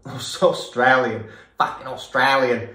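loud, laughing, hammed-up Australian accent. Reactor retries Felix's Australian accent, loud and laughing. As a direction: stretch out Australian slowly and laugh in a broad Australian accent. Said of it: "That was terrible".

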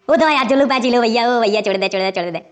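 low, sinking plea; acted begging. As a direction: beg to be let off, voice dropping away. Begging others to let it go.

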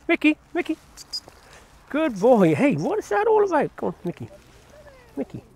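high-pitched puppy praise; high excited dog call. Real, not acted.

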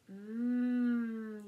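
usual speaking voice

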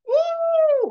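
sudden high whoop of alarm; loud woo. Retold or imitated. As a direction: yelp as the water hits, playful. Wall of water hits.

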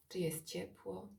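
ordinary talk, nothing marked

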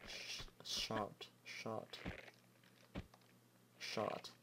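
whispered "shard" drilled softly; practising a word. Shown as a demonstration. Gamer quietly practising a hard word, whispered. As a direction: whisper "shard" over and over to get it right. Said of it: "That's the worst word ever".

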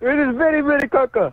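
put-on high-pitched comic Arabic-style accent; put-on foreign accent. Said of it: "You do that accent well".

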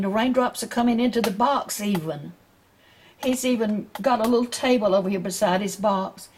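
ordinary delivery, nothing marked

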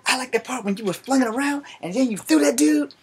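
high, amazed big-guy voice; impressed coworker. Retold or imitated. Coworker Chris praising the fight, high and amazed. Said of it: "It's like".

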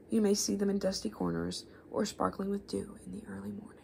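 hushed, breathy storytelling read; story narration. A narrator's reading. Reads a myth aloud, hushed.

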